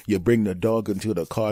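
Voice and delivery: usual speaking voice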